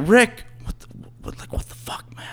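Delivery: hushed, voiceless aside to a friend; whispering. Retold or imitated. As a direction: whisper it as if someone is watching. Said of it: "Why are you whispering?".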